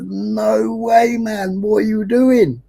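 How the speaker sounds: disbelief. Retold or imitated. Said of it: "And it's like".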